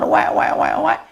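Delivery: breathy wek wek of a newborn crying; baby cry imitation. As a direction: mimic a baby's short cries, playful.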